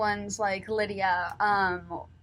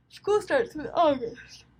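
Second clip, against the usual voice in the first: slow words stretched through a yawn; tired end-of-day yawn while speaking; 4 semitones higher than usual. Real, not acted. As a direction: talk through a real yawn. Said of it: "Oh, I'm yawning".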